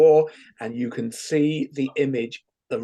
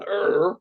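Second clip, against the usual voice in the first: stress leaving groan; 4 semitones higher than usual. Shown as a demonstration. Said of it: "the stress goes from".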